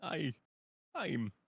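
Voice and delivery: ordinary talk, nothing marked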